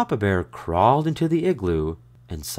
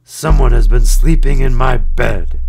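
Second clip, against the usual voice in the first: loud booming bear voice; bellowing; 5 semitones higher, 14 dB louder than usual. A narrator's reading. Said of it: "Papa Bear bellowed".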